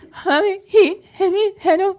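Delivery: put-on high, sobbing kid voice; imitating crying child. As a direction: fake a crying little boy. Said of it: "when Cy has been crying".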